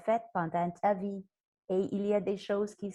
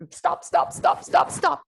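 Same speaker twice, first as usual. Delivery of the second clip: stop chuchoté-crié; 9 semitones higher, 7 dB louder than usual. Shown as a demonstration. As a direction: stop soufflé fort, sans voix. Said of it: "son petit brigadier qui passe". (brigadier qui siffle stop)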